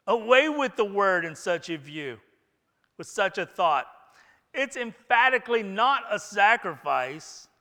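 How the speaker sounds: loud, high oratorical protest; impassioned declaimed quote; emphatic rejection. Acted. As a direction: thunder it out like a preacher. Acting Livingstone's emphatic rejection in quote.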